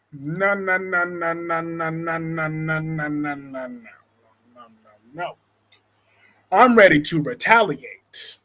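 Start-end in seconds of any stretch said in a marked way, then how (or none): 0.1-5.4 repeated no, fading from soft to very soft; dismayed no's dying to almost nothing. Real, not acted. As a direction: let the no's sink away in disbelief. Dismayed at friend's crash news.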